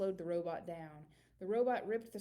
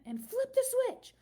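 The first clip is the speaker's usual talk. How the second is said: much higher, loud flip the switch; excited shout; 9 dB louder than usual. A narrator's reading. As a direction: yell it at the climax. Countdown climax.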